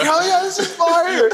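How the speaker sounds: hyped reply. Retold or imitated. Buddy shouting back from his car, high and hyped. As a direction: cheer it back like a hype man. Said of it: "He's like".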